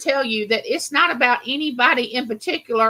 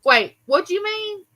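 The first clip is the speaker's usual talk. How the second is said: confused asking; 7 semitones higher than usual. Retold or imitated. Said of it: "I'll usually call and say".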